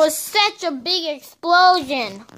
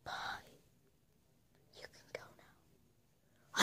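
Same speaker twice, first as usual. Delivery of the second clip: hushed whisper; 30 dB quieter than usual. Acted. Telling guest to leave.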